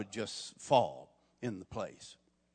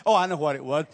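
usual speaking voice

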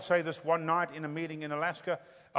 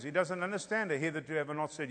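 ordinary talk, nothing marked

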